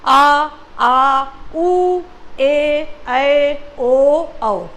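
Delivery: आ, उ, ए, ऐ, ओ, औ each held long; sustained, separate आ, उ, ए, ऐ, ओ, औ; long vowels. Shown as a demonstration. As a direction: stretch every vowel from आ to औ, teacher demonstrating. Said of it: "जानबूझ के धीरे धीरे बोल रहे हैं".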